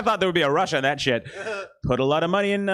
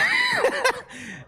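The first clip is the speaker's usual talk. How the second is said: very high, far-off screeching cry. Pretend. Comedian performing an impression, a high shriek.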